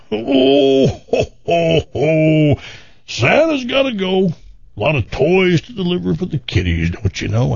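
high hammed-up Santa laughing ho ho ho; cheery Santa brushing off the offer; jolly Santa voice. Santa voice repeated in joke.

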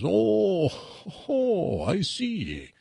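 knowing Jamaican oh, oh, I see; a sly, dawning Jamaican aside; mock Jamaican realisation; Jamaican accent. Joke voices the Jamaican attendant.

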